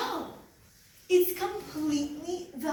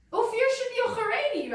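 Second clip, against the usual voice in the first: excited; 11 semitones higher, 7 dB louder, faster than usual. Real, not acted. Excited claim about cousin.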